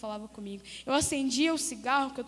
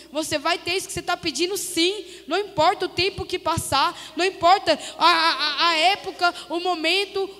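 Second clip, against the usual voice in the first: loud, raised, insistent preaching; pregação fervorosa; 3 semitones higher, 7 dB louder than usual. Real, not acted. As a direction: drive each promise home with force. Preaches at full force. (pregação enfática, em voz alta)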